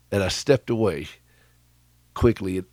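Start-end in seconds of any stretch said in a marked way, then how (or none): none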